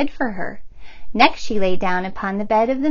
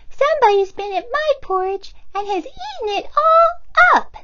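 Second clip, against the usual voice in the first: put-on very high squeaky baby bear; tiny bear, upset his food is gone; squeaky little cub wail; little small wee voice. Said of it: "in his little small wee voice".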